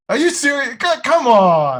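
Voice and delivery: amazed disbelief. Retold or imitated. Disbelief at a job offer.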